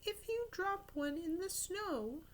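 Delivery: mild warning from grandmother. Acted. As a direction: caution the boy gently. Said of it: "she warned".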